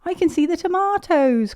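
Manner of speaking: high, loud excited spotting; excited cry. A narrator's reading. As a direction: call out with glee. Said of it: "cried Peppa".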